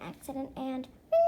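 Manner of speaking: normal talking voice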